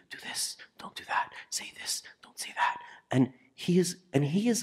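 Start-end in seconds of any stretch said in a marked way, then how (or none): 0.1-2.8 breathy unvoiced whisper. Preacher whispering God's inner guidance to the congregation. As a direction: whisper it like a voice inside your head. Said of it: "he's whispering to you".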